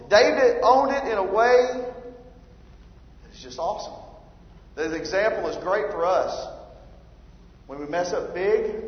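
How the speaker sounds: ordinary talk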